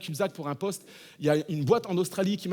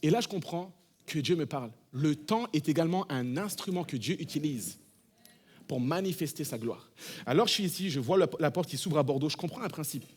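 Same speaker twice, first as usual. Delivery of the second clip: usual speaking voice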